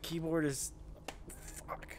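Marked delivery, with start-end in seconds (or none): 1.7-2.0 under-the-breath swear; hissed whisper; whispered curse. Real, not acted. Frustrated swear while playing.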